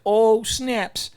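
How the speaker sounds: high, loud exclamation of surprise; retold surprise. Reviewer reacting to the suspicious part, retold surprise.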